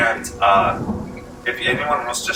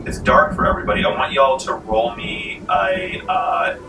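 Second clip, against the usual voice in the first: normal talking voice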